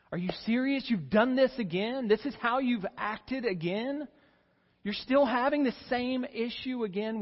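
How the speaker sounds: loud, high, exasperated rebuke; exasperated scolding. Retold or imitated. Pastor voicing an imagined harsh Christ, loud exasperated scolding. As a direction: scold like a fed-up parent.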